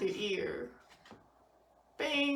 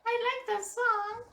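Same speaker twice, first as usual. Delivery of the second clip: squeaky high, louder puppet voice; 12 semitones higher, 5 dB louder than usual. Pretend. Dad voices a toy puppet after singing, high pitched. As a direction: chirp it like a toy dog.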